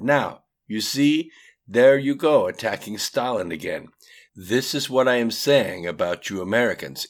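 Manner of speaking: put-on indignant Russian-accented interruption. A narrator's reading. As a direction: cut in loudly, Russian-accented. Atium interrupts.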